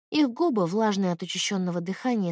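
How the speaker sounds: plain reading voice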